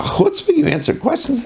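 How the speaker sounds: high-pitched, incredulous outrage; indignant scolding. Retold or imitated. Rav confronts the newcomer over his answers, indignant. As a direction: scold as if scandalized by the nerve.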